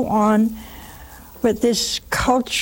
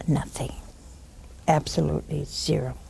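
"you have nothing" whispered low and soft; hushed whisper; 7 semitones lower, 9 dB quieter than usual. Real, not acted. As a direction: let the voice sink into a solemn whisper. Solemn point about the Eucharist.

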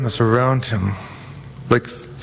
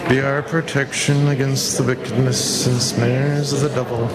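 solemn prayer. Acted. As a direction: pray it humbly and steadily. Recites the Saint Michael prayer.

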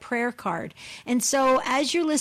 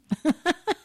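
high chuckling laugh; amused burst of laughter; 6 semitones higher than usual. Real, not acted. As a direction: laugh along at the joke. Laughing after praising the priest.

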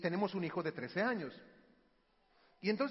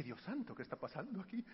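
hushed alarm; 2 semitones lower, 10 dB quieter than usual. Retold or imitated. Father to himself as a girl approaches his son, hushed alarm. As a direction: keep it under your breath.

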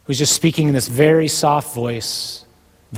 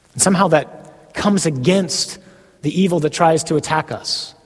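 ordinary talk, nothing marked

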